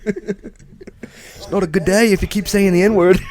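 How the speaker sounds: high, drawn-out mock English-Australian; English-Australian mixture. Co-host teases the Australian character, high slow mock English-Australian accent. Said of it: "The English Australian".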